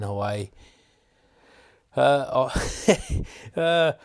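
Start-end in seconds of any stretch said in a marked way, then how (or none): 2.0-3.9 short jittery laugh; nervous laugh. Real, not acted. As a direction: laugh nervously, half under your breath. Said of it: "that's my bit of a nervous laugh there".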